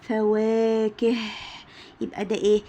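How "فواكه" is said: teacher demo of فواكه, gentle ه; slow demo word. Shown as a demonstration. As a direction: stretch فواكه and let the ه breathe out. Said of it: "صوتها هادي وحلو أهو".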